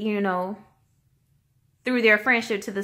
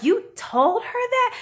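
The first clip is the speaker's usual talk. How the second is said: upset disbelief; 11 semitones higher, slower than usual. Retold or imitated. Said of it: "she's getting so upset".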